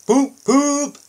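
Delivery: high loud train whistle "poop, poop"; two high piercing whistle toots. Acted. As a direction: toot it like a snooty engine whistle. Gordon's whistle.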